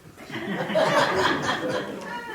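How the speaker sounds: high loud nervous giggle; nervous high laugh. Acted. Nervous laugh deflecting recognition.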